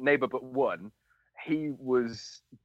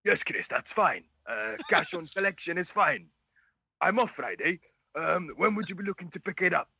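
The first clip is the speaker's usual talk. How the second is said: lilting South Walian, pitched up; [name], South Walian accent; 5 semitones higher than usual. Acted. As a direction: put on a South Wales accent for a seller's reply. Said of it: "do the South Walian accent".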